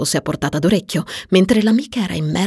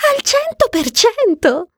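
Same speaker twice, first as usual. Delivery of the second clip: high, slow, thrilled confirmation; high-pitched triumphant assurance; emphatic certainty; slower than usual. Acted. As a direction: stretch it out high, utterly sure. Marion excitedly confirms to her friend.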